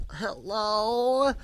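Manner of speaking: imitating a wary older lady, high-pitched; high, questioning mock hello; freaked-out neighbor. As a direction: put on a startled neighbor answering the door. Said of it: "totally freaked out who was ringing".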